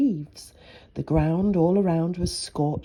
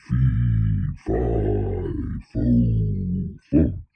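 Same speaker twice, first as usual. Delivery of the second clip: far lower, booming giant chant; slower than usual. Acted. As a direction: rumble it out like a giant. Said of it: "which is what giants do say".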